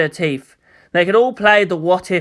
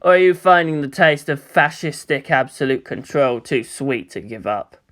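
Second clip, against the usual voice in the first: smirking taunt; 3 semitones lower than usual. Acted. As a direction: savor the jab. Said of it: "Gendo smirked slightly".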